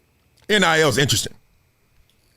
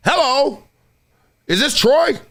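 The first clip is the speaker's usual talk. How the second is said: phone call greeting; 10 semitones higher, slower than usual. Retold or imitated. Booster calling Troy.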